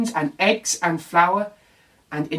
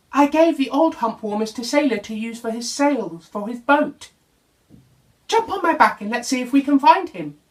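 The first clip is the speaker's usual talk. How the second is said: a touch higher, chatty camel explaining; friendly camel owning up cheerfully; Camel, lightly voiced; 7 semitones higher than usual. Camel tells Little Bear about the hump warmers, lightly higher.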